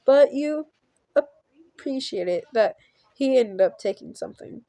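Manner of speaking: usual speaking voice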